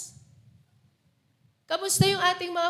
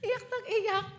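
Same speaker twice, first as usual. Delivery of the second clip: laughing; 5 semitones higher, 4 dB quieter than usual. Real, not acted. Laughing while telling story.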